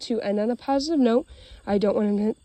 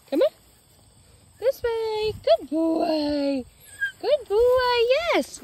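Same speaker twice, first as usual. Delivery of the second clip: high dog praise; 12 semitones higher, much slower than usual. Real, not acted. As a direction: cheer him on like a puppy.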